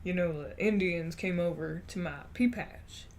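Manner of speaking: imitating great-grandfather's soft Texan accent; lightly voiced old Southern grandpa storyteller; gentle put-on Southern drawl. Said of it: "So he would be like".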